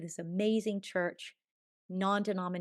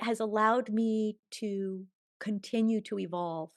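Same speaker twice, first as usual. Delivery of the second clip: ordinary talk, nothing marked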